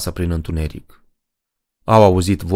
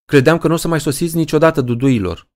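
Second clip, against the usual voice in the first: fast, much higher eager exclamation; exclaiming; 9 semitones higher, faster than usual. Acted. Helen greets her late friends from the porch, exclaiming. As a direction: rush out the greeting teasingly. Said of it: "exclamă Helen Greer".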